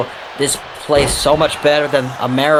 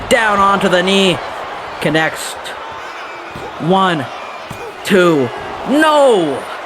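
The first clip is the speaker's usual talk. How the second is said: raised count bursting into a high cry; excited near-fall call; 5 semitones higher, slower than usual. Real, not acted. Commentator counting a near-fall, excited. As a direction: build the count to a shocked shout.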